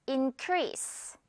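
soft increase ending on a clear s; model for repeating. Shown as a demonstration. Teacher modelling increase for learners to repeat. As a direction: say increase softly for students to copy. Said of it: "듣고 따라해주세요".

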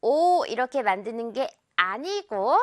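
normal talking voice

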